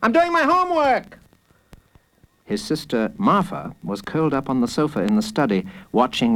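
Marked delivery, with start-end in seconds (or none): 0.0-1.2 high, indignant yell; annoyed, high-pitched holler; big brother shouting. A narrator's reading. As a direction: shout it to get rid of him. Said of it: "shouted Daniel".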